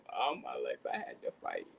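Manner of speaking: low, hammed-up tearful lament; mock sob-story delivery; fake crying. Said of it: "You gotta fake cry now".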